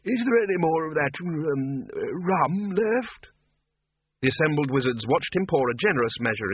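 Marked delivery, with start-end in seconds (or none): 0.0-3.3 put-on high creaky old man's voice; chirpy doddering wizard; high thin old man, chipper; old Windle. A narrator's reading.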